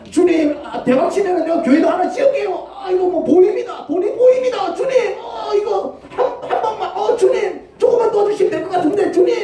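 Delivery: high, loud, eager plea; greedy excitement. Acted. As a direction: pitch the jackpot dream to God. Greedy believer begging God. (대박을 꿈꾸는 신자의 들뜬 외침)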